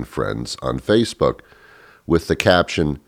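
ordinary delivery

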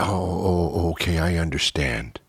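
very soft imitation of waitress; hushed, accommodating little reply; meek understanding. Waitress agreeing to move the diner's seat, meek.

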